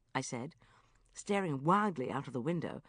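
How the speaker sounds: ordinary delivery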